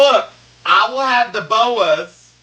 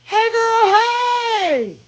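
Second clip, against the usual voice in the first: excited shout; 13 semitones higher, much slower than usual. Real, not acted. Shouting greeting to viewer.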